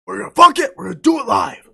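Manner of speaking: loud, high, exasperated shout; O'Reilly outburst. Acted. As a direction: yell it like a fed-up TV host on air. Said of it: "No, no O'Reilly".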